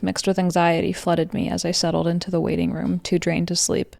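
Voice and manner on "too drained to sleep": ordinary delivery, nothing marked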